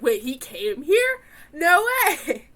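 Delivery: shocked disbelief. Retold or imitated. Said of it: "I was like".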